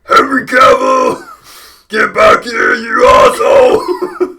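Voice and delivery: very loud, high, slow orc yell; orc voiceover. Acted. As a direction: scream it like an enraged orc. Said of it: "we just voice over the orcs?".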